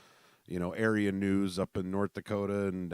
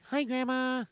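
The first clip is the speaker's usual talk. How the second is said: high, sugary phone greeting; sweet grandma voice; 5 dB louder than usual. Retold or imitated. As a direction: switch to a syrupy sweet-talk voice. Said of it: "my best grandma voice on".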